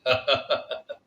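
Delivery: short scornful husband's laugh; husband laughing at his wife's request; mocking laugh. A narrator's reading. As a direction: laugh at her cruelly. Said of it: "وہ ہنستا ہوا بولا".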